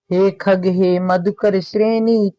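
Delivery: slow, measured verse recital; slow recitation. Shown as a demonstration. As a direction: recite it slowly like a poem. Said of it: "reciting the verse, set against plain quote". (धीमा काव्य पाठ)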